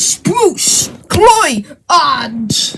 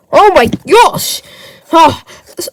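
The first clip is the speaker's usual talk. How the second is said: ordinary delivery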